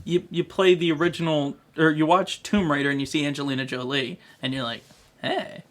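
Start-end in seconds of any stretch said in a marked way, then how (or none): none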